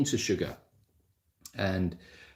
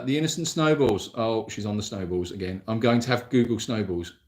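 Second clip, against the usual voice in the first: ordinary talk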